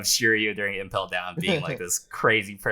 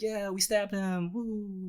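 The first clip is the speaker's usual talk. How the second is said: high, softer distant cheering; small falsetto crowd voice, trailing softer; half-hearted crowd cheer; 13 semitones higher, 6 dB quieter, slower than usual. Acted. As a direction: cheer faintly like henchmen in the back. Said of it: "they're all cheering in the back".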